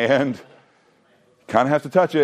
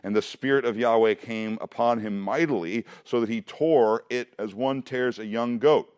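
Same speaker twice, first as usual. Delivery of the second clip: reading the verse aloud, a little higher; scripture reading; 6 semitones higher than usual. Acted. Teacher reading Samson's story aloud to his class, steady reading voice. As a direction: read the Bible passage to the class.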